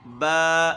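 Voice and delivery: با drawn out very slowly. Shown as a demonstration. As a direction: stretch با slowly to show it. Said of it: "اس طریقے سے ہم نے پڑھنا ہے". (با آہستہ کھینچ کر)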